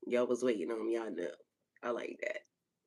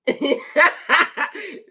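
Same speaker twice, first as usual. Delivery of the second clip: high, loud cackle; real laughing fit; 13 semitones higher, 13 dB louder than usual. Real, not acted. Host amused by crazy talk, cackling. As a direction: crack up in loud peals.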